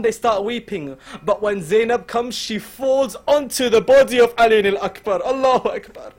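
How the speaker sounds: ordinary talk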